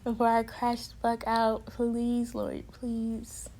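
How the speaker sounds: soft, drawn-out prayerful plea; softly beg, stretching each please; hushed pleading. Retold or imitated. Resident on their knees begs the Lord for help, quietly pleading. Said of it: "dropped onto my knees just saying".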